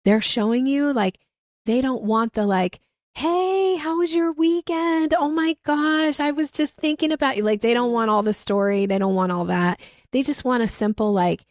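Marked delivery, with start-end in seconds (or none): 3.1-7.4 high, sing-song gushing friend voice, stretched out; mock the over-chatty emailer with a bright high gush; gushy small talk. Mocking a rambling email sent to Olivia, high gushing voice.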